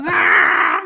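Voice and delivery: loud gasp for air; gasping shout. Retold or imitated. Bursting out of the pool, loud gasping cry. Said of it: "así de".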